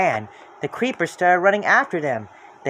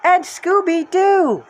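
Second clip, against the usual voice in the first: loud, high, slow addition; cheering; 5 dB louder, much slower than usual. Acted. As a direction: tack it on gleefully. Velma adding with delight.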